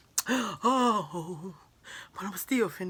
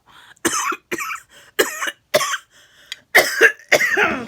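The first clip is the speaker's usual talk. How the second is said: harsh choking coughs; 11 dB louder than usual. Real, not acted. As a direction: cough hard, choking on cabbage. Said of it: "still choking a little bit".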